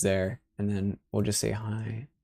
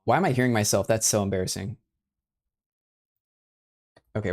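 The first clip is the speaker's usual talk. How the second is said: ordinary talk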